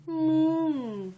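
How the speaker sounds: put-on long, high sung-out moo for a child; cow moo. Reading to a toddler, a gentle high cow moo. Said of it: "says the cow".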